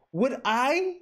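hammed-up sweet sincerity; mock pleading.

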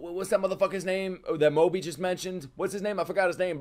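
usual speaking voice

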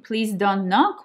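ordinary talk, nothing marked

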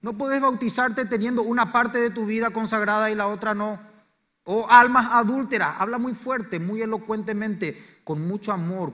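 loud, high, urgent preaching; preaching at full force. Acted. Preacher warning candidates before baptism, loud and high. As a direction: thunder it at the congregation.

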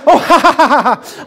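loud, high, forced laugh; covering embarrassment with a big fake laugh; embarrassed laugh. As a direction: laugh off the awkward misunderstanding.

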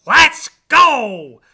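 loud, high rooster crow; big crowed rallying cry. A narrator's reading. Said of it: "crowed Cocky Locky".

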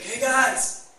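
high, loud shout to people far off; yelled call. Retold or imitated. Jesus calls from the shore to fishermen, yelling. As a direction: holler out to grab their attention. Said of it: "he yells to them".

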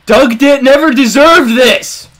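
outraged shout. Real, not acted. Outraged at burned house.

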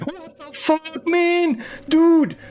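very high, loud stretched disbelief; shocked shriek. Real, not acted. Aparece algo grotesco. (grito agudo de asombro)

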